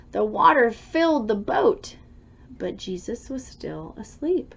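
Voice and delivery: ordinary delivery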